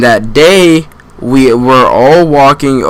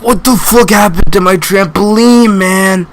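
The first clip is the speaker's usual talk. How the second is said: high, hammed-up angry kid; mock outrage at the wrecked trampoline; mock outraged yell; 9 semitones higher than usual. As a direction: imitate the furious owner, pitched way up. Angry kid finds broken trampoline.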